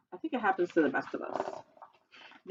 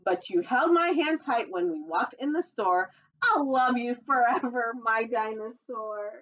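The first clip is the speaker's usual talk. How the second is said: lively sing-song storybook read, higher; reading to children; 5 semitones higher than usual. Acted. Reading a dinosaur picture book to children, sing-song storytelling voice. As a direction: read the rhyme brightly to little listeners.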